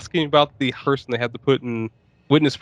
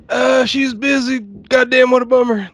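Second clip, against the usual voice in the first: mock clerk; 10 semitones higher, 4 dB louder than usual. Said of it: "It's like".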